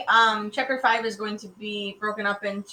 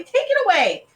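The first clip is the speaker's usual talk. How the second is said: high, loud squealed demand; alarmed little sister; squealing girl; 10 dB louder than usual. A narrator's reading. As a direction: squeal to get the frog away. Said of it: "squealed Sally".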